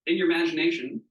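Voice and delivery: ordinary delivery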